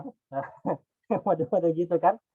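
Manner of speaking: ordinary delivery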